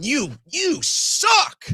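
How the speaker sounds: slow, shouted insult; angry shout. Acted. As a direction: drag out a furious yell. Insulting Trump directly.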